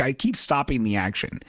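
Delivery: ordinary talk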